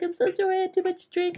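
high, whiny mock apology; squeaky, exaggerated sorry-I-was-drunk voice; mimicking the friend's high apology; imitating apologetic friend. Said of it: "she apologized and said, like".

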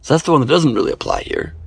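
normal talking voice

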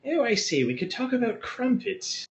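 high mock posh English accent; shitty English accent. To Alex, a hammed-up high English accent. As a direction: ham up a bad English toff. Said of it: "in a really shitty English accent".

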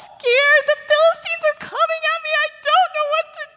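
very high, loud, hammed-up crying; scared whining. Saul apologising to Samuel in terror, hammed-up scared wailing. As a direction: wail like a mock-terrified crybaby, squeaky and frantic. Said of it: "I got so scared".

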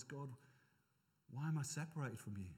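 low, softened cry to God; quiet cry. Acted. Preacher voices Jesus separated from God, quiet plea. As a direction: plead quietly, pitched low.

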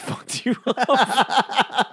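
high, slow, laughing put-on friend voice; laughing remark. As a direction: laugh it out, amused. Voicing Matt's laughing remark.

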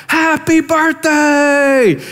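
high, drawn-out shouted birthday cheer; mock crowd shout. Prostitutes surprising Agnes at the bar, big shout. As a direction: belt it out like a roomful of friends. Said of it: "they shout".

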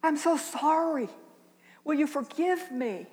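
put-on high, pleading little boy; small boy apologizing. Grandma voicing grandson begging forgiveness, high and pleading. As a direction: plead like a sorry child. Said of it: "he gasped".

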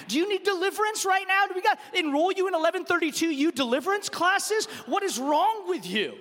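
hammed-up parental tirade; indignant scolding. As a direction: scold your son as if scandalized. Dad scolding son for liking losing.

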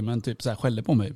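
ordinary talk

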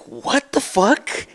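slow, drawn-out disbelief; a slow stunned what the fuck. Retold or imitated. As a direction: let it stretch out in shock. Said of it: "And it was just like".

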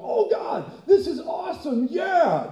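thrilled angels cheering God on; excited angel shout. Acted. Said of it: "the angels start to go".

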